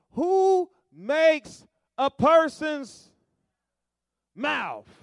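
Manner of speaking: high, loud, slow voice of God; slow, loud, high booming voice of God. Acted. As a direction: thunder it out as the Lord.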